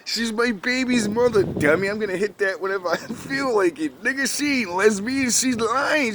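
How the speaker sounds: mock a guy laughing someone off; squeaky mocking jeer at the woman; mocking man. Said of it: "he's laughing at her like".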